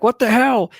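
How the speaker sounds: high, loud startled shout of a scared delivery man; startled yelp. Retold or imitated. Delivery guy startled by a mountain lion, yelling high and loud. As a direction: yelp it out like you just got jumped. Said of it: "It's like".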